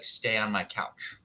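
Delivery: ordinary delivery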